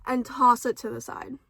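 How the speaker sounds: normal talking voice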